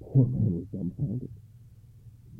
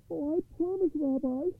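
high, anxious pleading voice; high-pitched troubled poor man; 4 dB quieter than usual. A narrator's reading. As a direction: promise eagerly in a high, worried voice. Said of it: "answered the troubled man".

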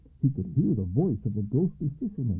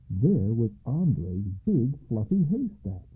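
usual speaking voice

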